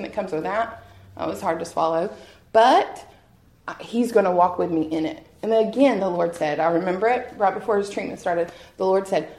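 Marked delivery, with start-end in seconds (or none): none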